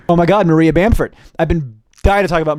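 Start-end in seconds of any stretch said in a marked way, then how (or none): none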